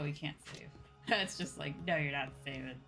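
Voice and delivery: ordinary talk, nothing marked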